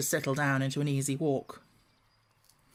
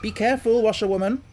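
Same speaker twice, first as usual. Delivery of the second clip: louder, higher warning call; 6 semitones higher, 6 dB louder than usual. Acted. Gentleman calling out warningly to the speeding driver. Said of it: "call out warningly".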